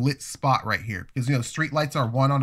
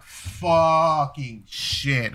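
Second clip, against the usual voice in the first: embarrassed groan; much slower than usual. Retold or imitated. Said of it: "I'm like".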